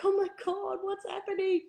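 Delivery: high panicked gasp; high, breathless alarm. Retold or imitated. Panicked heart opening retold.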